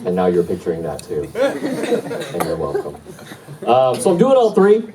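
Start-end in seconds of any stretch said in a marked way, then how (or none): 1.3-3.0 slow, drawn-out smug aside with a laugh; milk it slowly, laughing at your own joke; smug you're welcome. Real, not acted. 3.6-4.8 much higher and louder, rising on "doing"; excited high pitch. Real, not acted. Retelling multitasking, excited climb.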